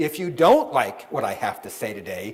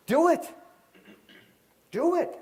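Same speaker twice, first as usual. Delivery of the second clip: high, insistent push to just do it; a retold wife egging him on, raised high; urging wife; 14 semitones higher than usual. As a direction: nudge him, much higher pitch. Wife urging him to finally write.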